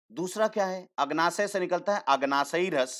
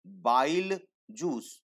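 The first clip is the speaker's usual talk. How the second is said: slow drill; much slower than usual. Shown as a demonstration. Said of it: "क्या बोलते हैं हम इसको".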